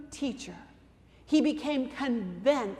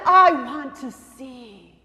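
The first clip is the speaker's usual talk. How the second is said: slow, louder, firm request; a bold plea to Jesus, slow and loud; unashamed plea; 10 dB louder, slower than usual. Acted. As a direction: say it boldly, with no hesitation. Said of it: "spoke out without shame or hesitation".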